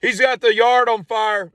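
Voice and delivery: high, loud sister yelling for Dad; a little sister ratting him out at full volume; tattling call to Dad. Retold or imitated. Sister shouting upstairs to Dad, high and loud.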